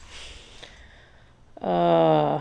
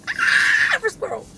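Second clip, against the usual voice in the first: startled shriek; 9 dB louder than usual. Real, not acted. Startled in car.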